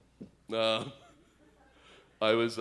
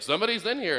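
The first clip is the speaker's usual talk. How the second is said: much higher, pointed "Somebody's in here" with "in" falling; annoyed repeat; 12 semitones higher, 3 dB louder, much faster than usual. Retold or imitated. Person in the bathroom repeating, pitched up and annoyed. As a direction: snap "Somebody's in here" up high, exasperated. Person inside answers again.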